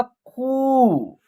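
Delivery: खू with a long-held ऊ; long vowel. Shown as a demonstration. Teacher showing ख with बड़ी ऊ, खू held long. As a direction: draw out the vowel of खू. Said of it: "long तरीके से होगा".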